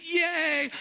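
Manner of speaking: very high, loud yay; mock cheer. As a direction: squeal of playful delight. Mock celebrating bunny milestones.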